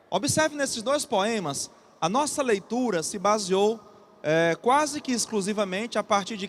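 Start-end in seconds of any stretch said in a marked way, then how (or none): none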